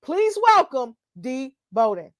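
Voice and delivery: ordinary delivery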